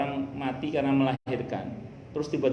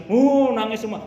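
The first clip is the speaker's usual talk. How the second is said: loud, raised "uh" imitating a crowd bursting into tears; high, loud retold outburst; mock communal outburst; 7 semitones higher, 8 dB louder, slower than usual. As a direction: act out everyone suddenly crying, without real sobs. Mimics mourners bursting into tears.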